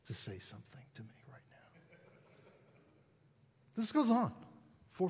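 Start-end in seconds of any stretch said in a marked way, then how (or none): 0.1-2.0 low, hissed dare; menacing whisper of a sulky teen; unvoiced, low threat; defiant whisper. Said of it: "This is how she looked".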